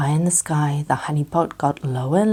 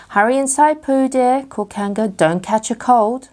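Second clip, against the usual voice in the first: higher, louder motherly call; Kanga calling; 7 semitones higher, 3 dB louder than usual. A narrator's reading. Kanga calling Pooh out of the rain, motherly.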